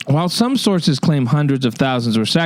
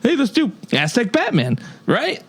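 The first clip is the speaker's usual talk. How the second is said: incredulous rant; 8 semitones higher than usual. Real, not acted. Ranting about the Aztec Batman idea.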